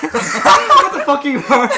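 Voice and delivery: loud, high, drawn-out cry through laughter; laughing outburst. Real, not acted. Co-host reacting to a friend's top, helpless laughing shout. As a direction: shout it incredulously while cracking up.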